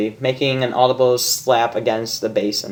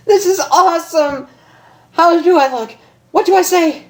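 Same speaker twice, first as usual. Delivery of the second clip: much higher, louder giddy excitement; beaming Rainbow; 4 dB louder than usual. Acted. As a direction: bounce with nervous joy. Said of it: "Rainbow beamed".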